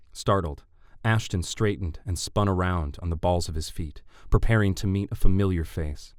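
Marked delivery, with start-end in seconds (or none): none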